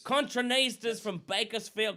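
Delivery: put-on loud, hammy Australian accent. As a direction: announce it like a bad Aussie. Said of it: "These are the worst accents you'll ever hear".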